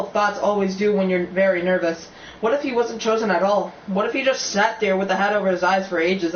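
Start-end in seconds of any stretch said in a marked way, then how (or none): none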